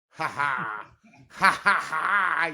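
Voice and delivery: a hammed-up cartoon villain cackle; villain laugh. Jokes about blackmailing friend.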